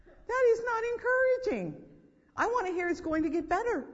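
loud, high-pitched outburst; exasperated protest. Acted. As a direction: cry out in protest at the gloomy visitor. Protests against discouraging visitors.

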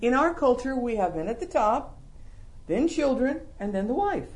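ordinary talk, nothing marked

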